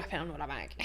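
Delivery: low, hushed mock dealer; lightly imitated furtive dealer; drug dealer. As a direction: drop it low and quiet, like a shady deal. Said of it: "You sound like a drug dealer".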